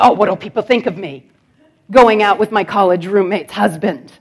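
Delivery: hammed-up worried old lady; worried grandmother. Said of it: "She'd be like".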